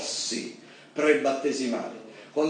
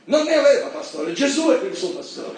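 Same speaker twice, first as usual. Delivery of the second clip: high, loud preaching at full force; rising loud sermon fervour; fiery preaching; 8 semitones higher, 4 dB louder than usual. Acted. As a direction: drive the point home from the pulpit.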